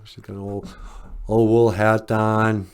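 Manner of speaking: ordinary delivery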